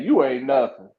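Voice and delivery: low, dismissive quoted jab; low-voiced dismissal; wrestler's put-down. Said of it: "That's basically what he said".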